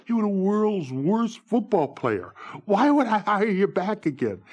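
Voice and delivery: a boss laughing off a bad player; scoffing team owner.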